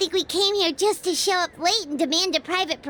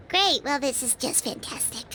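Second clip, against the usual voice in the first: dry, lower and softer sarcasm; sarcastic; 8 semitones lower, 4 dB quieter than usual. Acted. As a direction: mutter it with dry irony. The owner is with Hu Tao.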